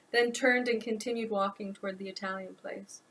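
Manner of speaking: usual speaking voice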